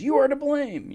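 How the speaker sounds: high, loud, imitating a weeping woman; a hammed-up tearful outburst; mock crying accusation. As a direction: throw the blame out through mock tears. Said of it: "is crying and says".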